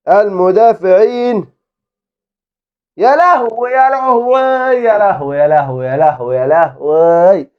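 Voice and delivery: high, drawn-out panic; alarmed cries. Real, not acted. Gamer panicking as defenders fail. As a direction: cry out at the defenders.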